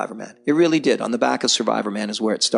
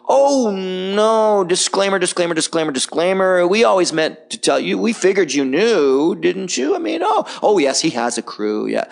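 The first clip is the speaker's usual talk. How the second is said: mock backtracking; 7 semitones higher than usual. Network backtracks to viewers after the scandal, mock flustered. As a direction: play up a flustered, insincere excuse.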